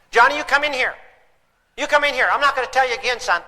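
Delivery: hammed-up parent calling a kid inside; mock yelling parent. Exasperated parent calling son Johnny in, mock yell.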